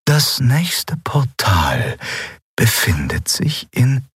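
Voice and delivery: very slow, drawn-out spirit voice; Eidolon, solemn. A narrator's reading. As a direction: reveal it slowly, with weight. Spirit figure begins the revelation. (langsam und geheimnisvoll)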